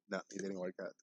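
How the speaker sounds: normal talking voice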